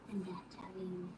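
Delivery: trailing off into a whisper; whispered afterthought. Real, not acted. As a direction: drop to a breathy whisper at the end.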